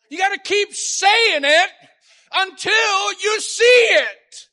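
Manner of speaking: high, loud shout, slowing at the end; exhorting shout. Real, not acted. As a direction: drive it into the crowd. Preacher exhorts congregation.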